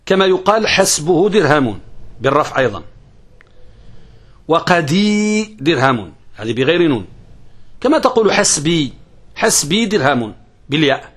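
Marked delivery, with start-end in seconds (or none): none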